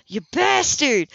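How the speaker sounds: loud, high, spat with loathing; a boy snapping at Ethan in fury; furious snap. A narrator's reading. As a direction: hurl the insult at him. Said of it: "loathing ... as he snapped".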